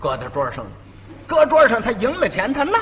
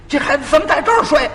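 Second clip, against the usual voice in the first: fast, higher angry outburst; exploding master; 7 semitones higher, 4 dB louder, faster than usual. Retold or imitated. Master comes home and blows up at the apprentice, fast and high. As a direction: blow up at the sleeping boy. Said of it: "一瞧就炸了".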